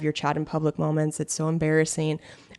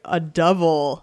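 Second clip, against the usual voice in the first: ordinary delivery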